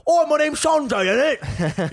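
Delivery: mock Birmingham accent. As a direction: play a Brummie lad. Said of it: "he grew up in fucking Birmingham".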